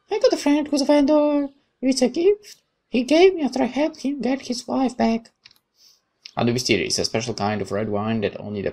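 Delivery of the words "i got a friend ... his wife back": put-on much higher, bright girlish voice; cheerful young woman. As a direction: play the friendly girl who sneaks up, chirpy.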